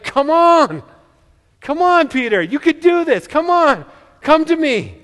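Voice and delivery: high, loud, beaming call of encouragement; laughing encouragement. Retold or imitated. Jesus grinning as he calls Peter across the water, cheerful encouragement. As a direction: coax him on with a grin in the voice. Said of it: "maybe a laugh in his voice".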